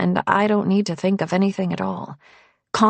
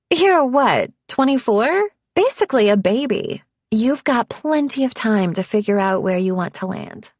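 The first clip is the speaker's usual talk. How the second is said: higher, louder, breezy patronizing tone; condescending woman; 4 semitones higher, 4 dB louder than usual. Acted. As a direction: wave it off patronizingly. Alexis voiced, condescending.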